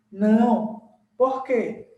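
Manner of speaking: ordinary delivery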